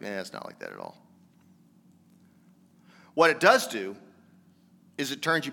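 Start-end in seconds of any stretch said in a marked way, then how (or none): 0.0-1.0 shrugging it off. Retold or imitated. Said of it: "They're like".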